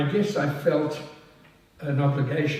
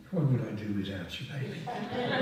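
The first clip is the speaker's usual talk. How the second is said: soft teasing aside; 6 semitones lower, 8 dB quieter than usual. Real, not acted.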